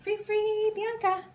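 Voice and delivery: high, loud happy release; excited release call. Real, not acted. Trainer releasing the waiting dog, high and excited. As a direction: cheer her free.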